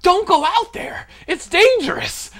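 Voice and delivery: like he just sucked a helium balloon. Pretend. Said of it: "sound like Jimmy Stewart on Helium".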